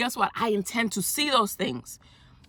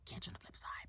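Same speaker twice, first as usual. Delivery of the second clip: whispered sign-off; 22 dB quieter, faster than usual. Real, not acted. Quiet sign-off ending the video.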